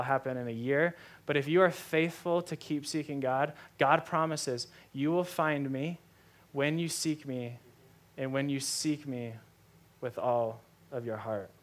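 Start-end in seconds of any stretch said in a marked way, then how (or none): 4.9-11.5 tender promise. Acted. Quoting God's promise, solemn.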